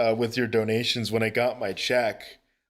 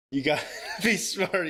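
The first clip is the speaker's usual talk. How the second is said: laughing; 6 semitones higher than usual. Real, not acted. Laughing while scolding donor.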